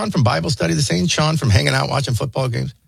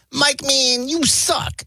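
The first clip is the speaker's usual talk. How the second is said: high-pitched put-on friend's voice; imitating Sean; 8 semitones higher, much slower than usual. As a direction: tease in a high mock voice. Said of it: "in Sean's voice".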